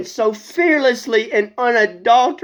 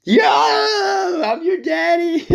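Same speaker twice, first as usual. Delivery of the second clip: boastful shout; 11 semitones higher, much slower than usual. Acted. Elijah bragging to the prophets, shouting high. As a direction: crow it out like a champion.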